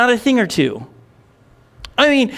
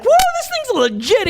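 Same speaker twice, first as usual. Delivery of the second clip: excited whoop. Retold or imitated. Retold joy at new gadget.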